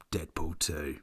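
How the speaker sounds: ordinary talk, nothing marked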